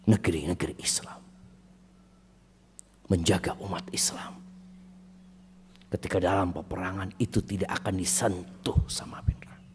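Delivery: hushed, breathy whisper. Acted. Preacher whispers to the congregation, solemn whisper. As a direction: lean in and whisper it. Solemn point about guarding eyes.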